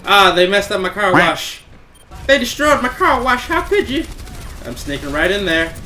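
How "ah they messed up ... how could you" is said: rising into a high, loud hammed-up wail; mock anguish. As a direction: overplay the heartbreak as a joke. Car wash destroyed in game.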